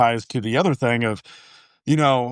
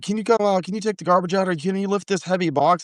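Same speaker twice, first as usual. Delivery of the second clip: raised pitch, pleading wife; higher wife voice; 7 semitones higher than usual. Retold or imitated. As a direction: ask sweetly for help. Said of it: "she'll be like".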